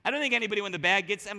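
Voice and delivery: usual speaking voice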